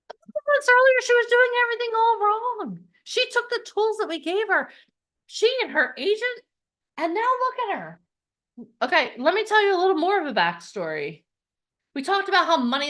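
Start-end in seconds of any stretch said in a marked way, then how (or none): 0.1-8.0 proud excitement. Real, not acted. Proud success retelling.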